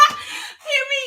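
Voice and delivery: high, loud words through laughter; laughing. Real, not acted. Streamer repeating herself to her chat, laughing high. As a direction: say it while cracking up.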